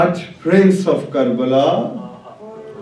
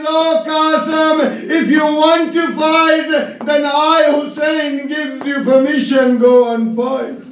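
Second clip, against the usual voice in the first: anguished cry of permission; 8 semitones higher than usual. Acted. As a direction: cry out in grief, then let it sink. Hussain gives Qasim permission, weeping.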